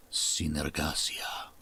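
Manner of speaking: low, slow, whispery foreign accent; accented ASMR read. Shown as a demonstration. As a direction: draw it out in a deep, hushed accent. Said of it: "almost my A S M R read on that".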